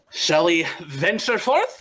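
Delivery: a slow, grand invitation to adventure; Nyctis grandly. Acted. Nyctis inviting the party to set off, slow and grand. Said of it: "Nyctis is like".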